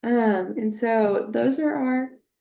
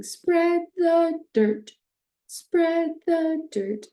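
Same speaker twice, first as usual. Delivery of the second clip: higher, louder, slow, leaning on spread; acoustic highlighting; 7 semitones higher, 4 dB louder, much slower than usual. Shown as a demonstration. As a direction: sing it out slowly for a child. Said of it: "finish by saying".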